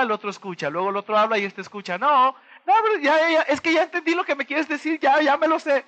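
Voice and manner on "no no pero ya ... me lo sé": high, dismissive "ya, ya" brush-off; impatient know-it-all. Retold or imitated. Speaker imitating a know-it-all who won't listen, high impatient brush-off. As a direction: brush them off, pitched higher, you already know.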